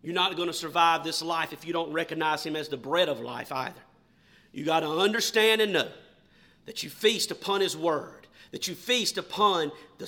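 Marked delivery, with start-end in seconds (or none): none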